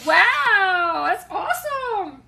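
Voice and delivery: loud "wow" gliding into a drawn-out cheer; amazed delight. Acted. Cheering on someone's plan to move, excited. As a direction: marvel at it slowly and grandly.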